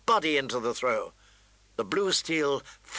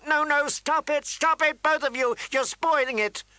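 high, loud panicked shriek; 12 semitones higher, 4 dB louder than usual. Acted. Girl shrieking at the fighting children to stop. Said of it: "Sansa was shrieking".

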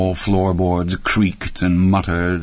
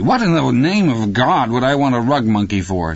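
high, disbelieving wife's voice; incredulous scorn; 6 semitones higher, 3 dB louder than usual. A narrator's reading. Wife dismissing idea of children, incredulous scorn. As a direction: ask as if he's utterly crazy. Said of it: "as if he'd gone utterly crazy".

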